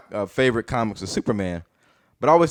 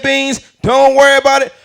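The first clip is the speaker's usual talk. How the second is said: booming, high-pitched comedian impression; Bernie Mac; 9 dB louder than usual. Mama's reassurance reread by an adult comic, loud high impression. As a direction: blare the comfort out like a brash comic. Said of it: "reread it as Bernie Mac's voice".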